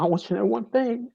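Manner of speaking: quoting the doctor, voice cracking high with tears; voice breaking. Real, not acted. Repeating the doctor's promise, voice breaking. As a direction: hold back the tears on the doctor's words. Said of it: "he saw tears".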